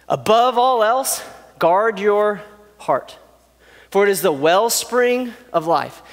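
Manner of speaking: slow, weighty proverb reading; solemn emphasis. Acted. Solomon's key advice, read slow and solemn. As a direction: lay each word down slowly for weight. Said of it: "this is what he says".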